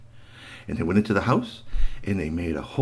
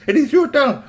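plain reading voice

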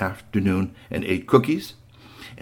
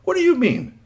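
put-on boy's voice; 14 semitones higher, 4 dB louder than usual. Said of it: "Strongbones said".

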